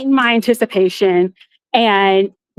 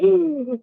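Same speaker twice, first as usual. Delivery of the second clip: quick, high, breathy intake of shock; sharp gasp; 6 semitones higher, 4 dB quieter than usual. Retold or imitated. Kicked out by Disney, a sharp shocked gasp. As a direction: gasp as if caught off guard. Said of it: "And I literally gasped".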